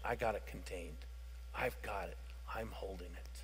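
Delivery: soft, low reassuring voice; quiet, tender promise; the Lord reassuring. Retold or imitated. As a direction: hush it like calming a frightened child. The Lord's reassuring voice, imagined.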